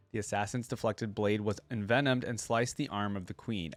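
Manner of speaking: ordinary talk, nothing marked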